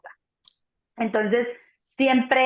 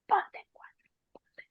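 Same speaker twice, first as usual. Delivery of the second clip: whispered, softer and softer; whispered inner voice; 6 dB quieter than usual. Retold or imitated. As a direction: whisper it like your body urging you. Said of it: "el cuerpo te dice". (susurro del cuerpo: ponte en cuatro)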